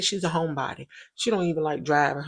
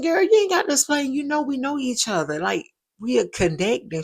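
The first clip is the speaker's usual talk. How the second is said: excited reassurance; 8 semitones higher than usual. Retold or imitated. To her busy new-mom friend, a high, excited reassurance. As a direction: brush off her apology with a happy burst.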